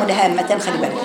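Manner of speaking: usual speaking voice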